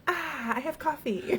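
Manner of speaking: high, amused mock sigh of "Ah"; laughing imitation. Host teasing a man about his coffee, laughing imitation. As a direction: imitate him through a laugh, pitched high. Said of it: "he's going".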